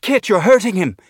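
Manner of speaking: high, loud panicked yell; panicked shout. A narrator's reading. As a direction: cry out in alarm to stop him. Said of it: "she shouted".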